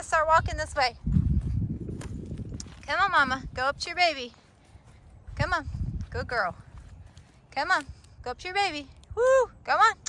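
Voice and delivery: high, sing-song calls to the cow; coaxing a cow. Real, not acted. Farmer calling the mama cow toward her calf, high coaxing. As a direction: coax her up the hill gently but firmly.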